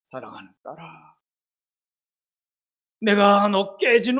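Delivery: a preacher's booming voice of God; God's voice. Retold or imitated. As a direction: speak as God comforting a daughter. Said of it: "하나님의 음성이 들리는".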